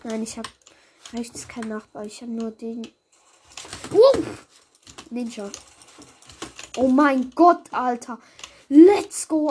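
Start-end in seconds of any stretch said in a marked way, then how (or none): 3.9-4.2 high loud surprised yelp; startled yelp. Real, not acted. Surprised by enemy. 6.8-9.5 loud triumphant cheer; excited cheer. Real, not acted. After winning a fight.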